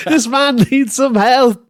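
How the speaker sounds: hammed-up call for a doctor; mock alarm. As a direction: pretend emergency call.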